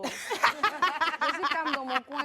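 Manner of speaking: laughing. Real, not acted. Laughing through her own line.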